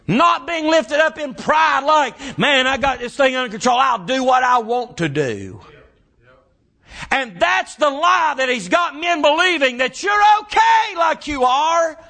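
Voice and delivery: shouted, high preaching cadence; preaching at full force. Acted. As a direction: lift it into a full-throated sermon shout.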